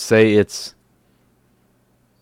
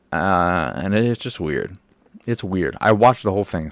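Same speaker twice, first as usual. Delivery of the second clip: usual speaking voice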